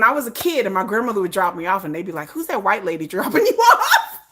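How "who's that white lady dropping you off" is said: high, loud question breaking into laughter; amused nosy question. Retold or imitated. Kids ask who dropped her off, incredulous and laughing. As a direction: blurt it incredulously, laughing on the end.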